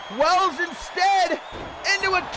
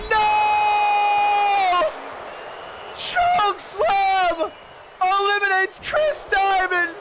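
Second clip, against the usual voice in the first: shocked shout; 5 semitones higher, much slower than usual. Real, not acted. Shocked elimination call.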